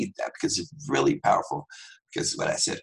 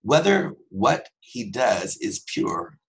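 slow scripture reading; slower than usual. Real, not acted. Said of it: "Even a child is known by his deeds".

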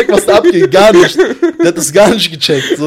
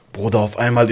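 ordinary delivery

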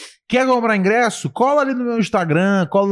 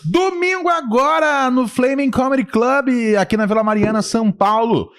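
ordinary delivery

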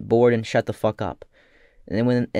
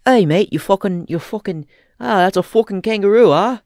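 hammed-up, higher-pitched Australian bloke; Australian accent; 6 semitones higher than usual. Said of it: "when you act as an Australian, you go".

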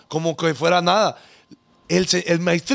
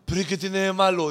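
usual speaking voice

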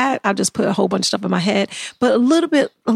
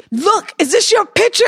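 mock driver; 10 semitones higher, 3 dB louder than usual. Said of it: "driver quoted".

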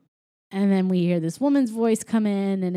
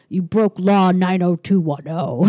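lightly voiced alien judge; a hint of a giant alien screen; 4 dB louder than usual. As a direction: read the charge like a stern alien court. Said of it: "And they said".

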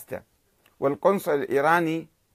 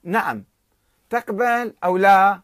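slow, louder and higher ultimatum; stern answer; 5 semitones higher, 7 dB louder, slower than usual. Retold or imitated. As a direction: demand a yes or no, sternly. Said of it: "فأجابه النخجواني بصرامة". (رد صارم بطيء وعالٍ)

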